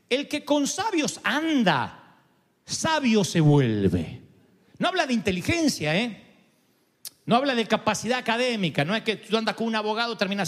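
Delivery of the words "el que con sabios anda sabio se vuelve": very slow, each phrase weighed out; slow solemn proverb. Acted. Preacher quoting Salomón to the congregation, very slow and solemn. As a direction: give the proverb weight, very slowly. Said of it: "Él dice".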